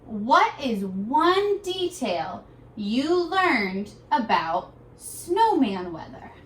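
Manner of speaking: very slow, clear reading pace; slow read-aloud. Shown as a demonstration. Teacher reading a worksheet question slowly to students. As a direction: read each word slowly for little readers. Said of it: "point at it while I read to you".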